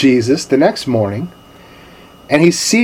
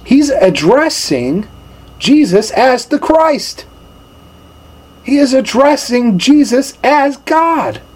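emphatic preaching; 10 semitones higher, slower than usual. Real, not acted. Preacher stressing the point.

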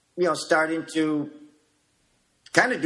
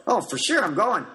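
eager yes; 7 semitones higher than usual. Retold or imitated. Accepting the training invitation eagerly.

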